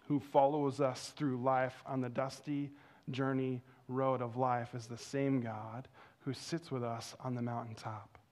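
normal talking voice

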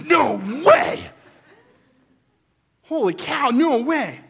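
very high, loud, hammed-up disbelief; astonished. Man sees the predicted couple arrive, acted astonishment.

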